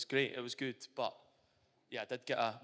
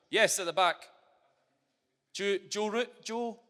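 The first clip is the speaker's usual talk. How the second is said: calling across the room, higher and louder; 6 semitones higher, 10 dB louder, slower than usual. Real, not acted. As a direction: call the name out to the back row. Calling on someone in audience.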